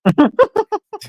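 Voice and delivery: high loud laugh; real laugh at the question; burst of laughter. Real, not acted. Laughing at the pundit's question.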